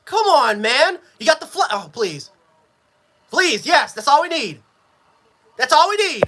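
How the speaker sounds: ordinary talk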